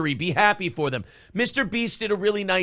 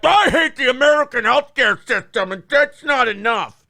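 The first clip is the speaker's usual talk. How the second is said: louder, higher whiny mock; 6 semitones higher, 6 dB louder than usual. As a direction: whine it like a sulky critic. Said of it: "what Hassan Piker took out of that".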